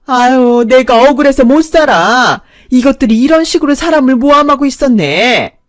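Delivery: 높고 큰 소리로 억울한 척 울부짖기; 과장되게 억울함을 떼쓰듯; 억지 쓰는 하소연. A narrator's reading. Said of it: "억지를 부렸고". (경찰 앞에서 억지 부리는 시어머니, 높은 소리로 떼쓰며)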